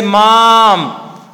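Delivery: "mom" drawn out slowly as a single falling tonic syllable. Shown as a demonstration. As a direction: say "mom" alone, slow and full, as the whole tone unit. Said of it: "like this, mom is the tonic syllable".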